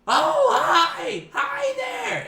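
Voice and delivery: very high, loud squeaky greeting; piercing goblin falsetto. Acted. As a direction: squeal hello like an overfriendly goblin. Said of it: "you hear a goblin say".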